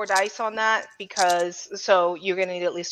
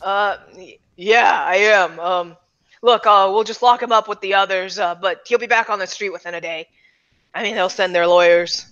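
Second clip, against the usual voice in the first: lower, hesitant cop voice; police officer; 3 semitones lower than usual. Acted. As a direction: answer low and unhurried, as a resigned cop. Game master voices police officer Rains.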